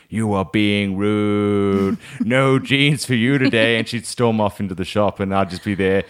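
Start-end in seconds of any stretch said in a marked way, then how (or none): 0.1-3.8 very slow, drawn-out telling-off; mock stern mother's final word; imitated scolding mum. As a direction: stretch each word as a scolding parent. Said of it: "it always finished like this".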